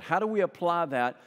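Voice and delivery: normal talking voice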